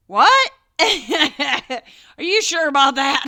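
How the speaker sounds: high, loud "What?" into a laughing, slow doubt; mock disbelief. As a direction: scoff at the kid through laughter. Parent mocking kid's Santa claim.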